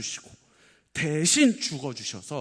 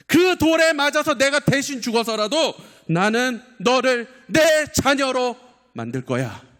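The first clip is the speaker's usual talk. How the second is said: high, very loud vow as God; God's imagined cry at full force; impassioned cry; 11 semitones higher, 11 dB louder than usual. Acted. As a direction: shout a fervent promise to a child. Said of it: "이렇게 외치시는 것만 같았어요".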